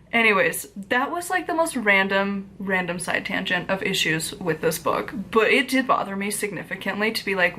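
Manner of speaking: normal talking voice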